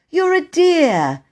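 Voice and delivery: high, drawn-out, loud thanks; grateful gushing. Acted. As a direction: thank him adoringly. Bobby thanks station master.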